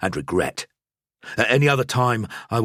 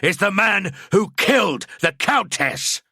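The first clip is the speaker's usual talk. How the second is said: wrathful proclamation; 13 semitones higher, 4 dB louder than usual. A narrator's reading. Priest denouncing the killer before the crowd, thundering wrath. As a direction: call down the wrath of the gods. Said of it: "a voice like the wrath of the gods".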